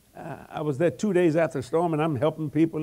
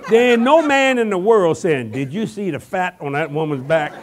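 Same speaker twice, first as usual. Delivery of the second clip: loud, laughing preaching climax, higher; full-force preaching; 6 semitones higher, 11 dB louder than usual. Real, not acted. Preacher setting the ladies straight, loud and laughing. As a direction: build the crowd up, shouting through laughter.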